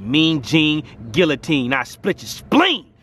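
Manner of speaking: slow, deliberate menacing rhyme. Acted. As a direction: drag out each word darkly.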